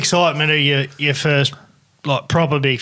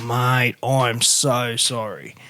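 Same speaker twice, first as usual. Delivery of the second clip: sympathetic; 5 semitones lower, 5 dB quieter, much slower than usual. Retold or imitated. Consoling mate after losing fish.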